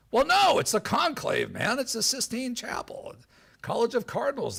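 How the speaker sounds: high-pitched defensive explaining; exasperated protest. Retold or imitated. Speaker plays KGB chief explaining the conclave to his boss, exasperated.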